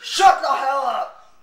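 slow, loud telling-off; angry shout. Pretend. Gamer silencing his friends, angry shout. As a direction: lean hard on each word to silence them. Yelling at friends during game.

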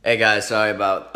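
loud, laughing hello; laughing greeting. Real, not acted. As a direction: burst in laughing. Loud laughing vlog intro.

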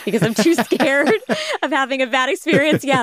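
laughing through the words; giggling while admitting a fear. Real, not acted. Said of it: "Sorry, I'm laughing right now".

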